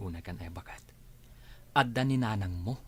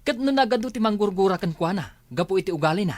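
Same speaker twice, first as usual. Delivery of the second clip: angry accusation; 6 semitones higher, 5 dB louder than usual. Acted. Husband accusing in quarrel.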